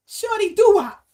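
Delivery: loud, high hype call to a fan; loud shout-out. Real, not acted. Host shouts out a viewer's name.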